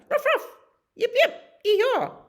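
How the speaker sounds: very high, squeaky barking into a whoop; high, gleeful barks and yee-haw; excited dog barks. Acted. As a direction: yap like an eager herding dog. Dog herding the cow.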